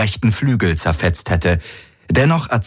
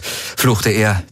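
ordinary talk

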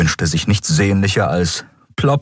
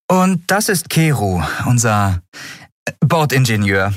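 higher female captain voice; calm and matter-of-fact; captain Andriel; 5 semitones higher than usual. Acted. Captain to Miko about the quarters, higher voice.

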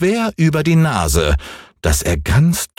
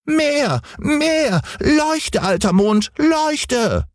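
sehr hohe Kinderstimme, drängend, nicht lauter; fordernder Häwelmann; much slower than usual. A narrator's reading. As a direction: ein kleiner Junge kommandiert den Mond. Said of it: "schrie Häwelmann". (sehr hohe, fordernde Kinderstimme)

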